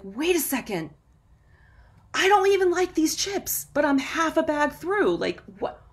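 jolted awake at a half-eaten bag; startled realization. Retold or imitated. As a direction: catch yourself mid-bite, stunned. Sudden realization while eating chips.

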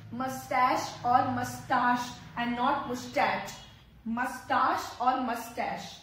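slow mustache "mus-tash", not "moosh-tach"; correct mustache. Shown as a demonstration. Teacher slowly contrasts mustache "mus-tash" and "moosh-tach". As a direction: mustache ends in "sh", unstressed "mus". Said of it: "the real pronunciation is mustache".

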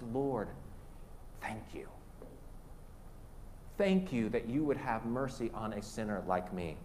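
low, soft, reverent thanks; humbled, quiet plea to the Lord; hushed grateful prayer. Acted. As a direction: drop to a near whisper, praying gratefully.

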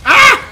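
sharp loud yelp of alarm; high startled yell. Real, not acted. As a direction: let out a sudden scream. Scream in fight.

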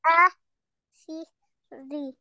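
"Asiri" very slowly as a, si, ri with gaps; learner's slow, separated "Asiri"; syllable by syllable. Shown as a demonstration. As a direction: sound out "Asiri" piece by piece. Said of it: "read it alphabet by alphabet".

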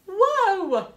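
high loud whoa of wonder; amazed whoa. A narrator's reading. Reading to kids, marvels as the plant shoots up. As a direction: marvel at the sight.

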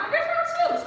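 very high, loud retold question; alarmed questioning. Woman questioning her crying friend, high-pitched alarm.

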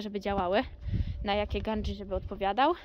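ordinary delivery

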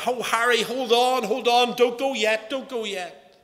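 urgent high, loud pleading; imitated shouting. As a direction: call out desperately to a friend slipping away. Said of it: "they were shouting at him".

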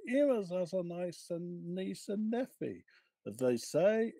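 Cockney washerwoman spreading tales; cockney gossip. Acted. Mrs Jones gossips about the squire's family, in a Cockney accent. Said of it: "can you please read for us in your best cockney accent".

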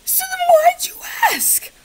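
very high strained shouted whisper; high rasping outraged screech; frustrated shriek. Real, not acted. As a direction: shriek the question in disbelief. Furious at chatbot.

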